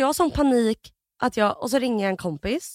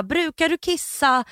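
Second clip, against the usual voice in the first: slow, higher imitated nurse; deliberate, raised-pitch questioning; 5 semitones higher, slower than usual. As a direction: spell the question out for the patient. Imitates nurse questioning confused patient.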